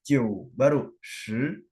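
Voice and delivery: Jiu, baru shi spelled out very slowly; slow Jiu then shi; very slow demo. Shown as a demonstration. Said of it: "bukannya kayak gitu".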